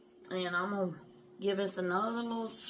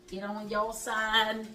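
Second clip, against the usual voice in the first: usual speaking voice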